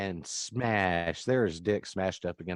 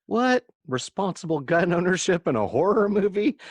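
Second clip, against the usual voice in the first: high, loud incredulous outburst; loud comic outrage; mock disbelief; 10 semitones higher, 7 dB louder than usual. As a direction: react as if scandalized, voice way up. Mock disbelief at the movie plot.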